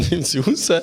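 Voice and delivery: normal talking voice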